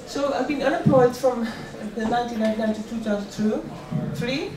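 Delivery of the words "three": ordinary talk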